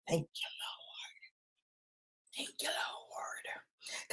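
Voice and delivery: slow, hushed whisper; whispered thanks. Real, not acted. Thanking God the kids moved out, a whisper. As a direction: breathe it out as a prayer.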